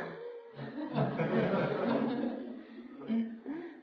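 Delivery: long real fit of laughing; laughter. Real, not acted. Amused while explaining raw.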